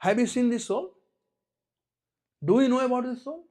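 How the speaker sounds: high, loud, pointed questions; small boy challenging. Acted. Small boy questions the father about soul, high and loud. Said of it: "Small boy, he said something".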